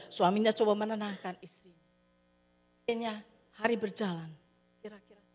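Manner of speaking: usual speaking voice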